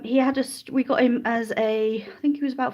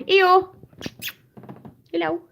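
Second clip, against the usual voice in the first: pitched-up dog call; 10 semitones higher than usual. Real, not acted. Calling the old dog.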